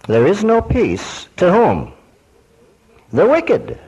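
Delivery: high, slow question, then the answer thrown out; preacher quizzing the congregation; rhetorical question. Real, not acted. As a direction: pose it to the crowd, then answer.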